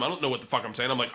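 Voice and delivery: ordinary talk, nothing marked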